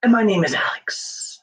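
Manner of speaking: hammed-up seduction, stretching into high, drawn-out phrases; pickup-line seducer. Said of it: "working on my pickup line".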